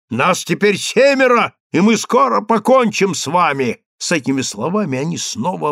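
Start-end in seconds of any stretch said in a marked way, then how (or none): 0.1-3.8 high, forceful warning; shouted threat. A narrator's reading. Greeks threaten to finish their foes, shouted threat. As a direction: threaten them with a raised voice. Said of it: "закричали греки".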